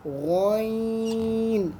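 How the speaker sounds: गॉइन drawn out very long with a gargled gh; long throaty ghain. Shown as a demonstration. Teacher demonstrating गॉइन to pupils. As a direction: stretch गॉइन slowly, then let it fall. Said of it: "difference pronunciation का".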